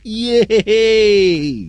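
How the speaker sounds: high, long drawn-out cheer; mock delight like a kid given a treat; childish glee. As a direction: squeal it with gleeful triumph. Acted Brezhnev delighted by compromise.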